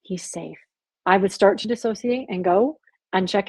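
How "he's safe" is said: low, hushed reassurance; retold whisper. The Lord reassuring a frightened wife, retold in a hushed voice. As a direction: say it softly, as if whispering to someone. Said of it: "the Lord would whisper to me".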